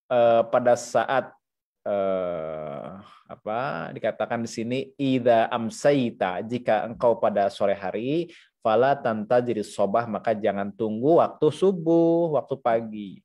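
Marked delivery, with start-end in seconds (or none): none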